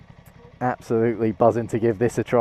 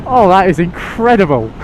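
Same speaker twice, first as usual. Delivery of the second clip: real exhilaration; 6 semitones higher than usual. Real, not acted. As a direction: exclaim it, buzzing from the acceleration. Thrilled by sport mode acceleration.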